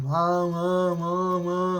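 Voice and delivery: muffled trombone-like wah wah; Charlie Brown adult voice. Retold or imitated. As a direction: mimic the muted teacher voice from Charlie Brown. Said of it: "It sounds like Charlie Brown".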